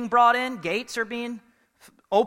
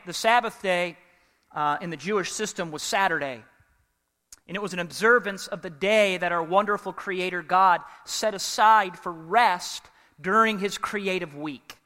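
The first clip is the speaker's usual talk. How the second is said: ordinary talk, nothing marked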